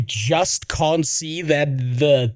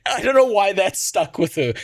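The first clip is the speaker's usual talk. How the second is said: ordinary delivery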